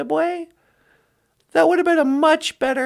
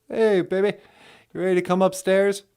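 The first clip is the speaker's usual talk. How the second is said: deep voice; 9 semitones lower, 3 dB quieter, faster than usual. Pretend. Voicing a deep-voiced caller.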